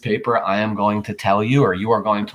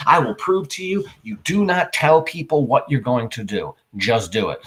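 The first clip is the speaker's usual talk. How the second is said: usual speaking voice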